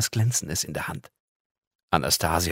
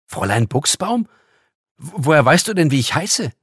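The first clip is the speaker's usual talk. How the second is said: louder, higher, stung repeat of the name; indignant; 7 semitones higher, 8 dB louder than usual. Acted. Girl repeating her hated surname, indignant and stammering. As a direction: throw the hated name back, offended. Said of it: "Den mochte sie nämlich überhaupt nicht".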